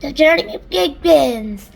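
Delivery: slow, loud proclamation; shouted. Acted. Centipede proclaiming the journey, slow loud shout. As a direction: announce it grandly. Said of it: "shouted the centipede".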